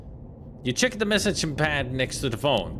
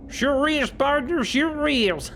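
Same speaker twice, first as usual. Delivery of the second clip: much higher, hammed-up cartoon cowboy; 13 semitones higher, slower than usual. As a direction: drawl it out like a squeaky old-timer. Cowboy impression continues.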